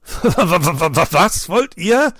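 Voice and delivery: very slow words through laughter, high and loud; bursting with laughter. A narrator's reading. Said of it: "Dann prustete Bronco los".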